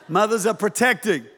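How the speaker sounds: ordinary talk, nothing marked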